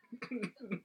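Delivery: short high-pitched laugh. Real, not acted. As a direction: burst out laughing. Laughs at own joke.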